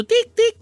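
high beep imitation; beeping noise. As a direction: mimic the scanner beeps. Imitating register beeps.

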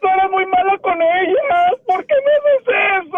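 tearful accusation breaking into a wail; llanto real. Real, not acted. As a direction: sob it out, rising on the last question. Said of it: "Ya, deja de llorar". (reproche llorando, termina en lamento)

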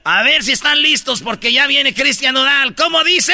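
MC hype announcement. Acted. Host promises [name] is coming, hyped and rising. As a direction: work up the crowd before the star.

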